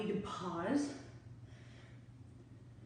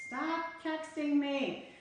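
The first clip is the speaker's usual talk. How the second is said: mock scolding; 7 semitones higher, much slower than usual. Scolding husband's texts on camera.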